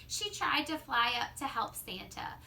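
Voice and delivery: usual speaking voice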